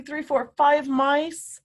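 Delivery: normal talking voice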